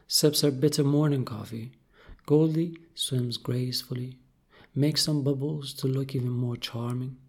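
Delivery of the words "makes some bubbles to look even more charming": ordinary delivery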